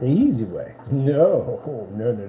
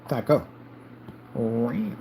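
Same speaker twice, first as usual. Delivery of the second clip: ordinary delivery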